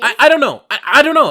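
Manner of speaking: high, loud, flustered "I don't know"; flustered dodge. Real, not acted. Guest flustered by the host's yogurt question, high and loud. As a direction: blurt it out high and loud, caught off guard.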